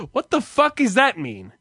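normal talking voice

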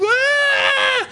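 high-pitched mock child wail; squealing put-on cry of joy; imitated happy cry. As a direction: burst into a fake overjoyed bawl. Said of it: "that type of cry where you don't know why".

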